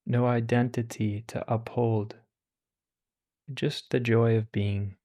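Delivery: calm, unhurried meditation voice with long pauses; guided meditation. Acted. Teacher guiding listeners through meditation, calm and unhurried. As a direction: ease listeners into stillness.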